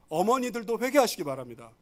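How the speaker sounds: ordinary talk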